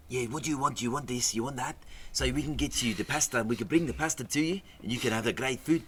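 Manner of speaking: high-pitched South African waiter patter; imitated South African accent. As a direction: take an order in a put-on South African accent. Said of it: "That's a good accent".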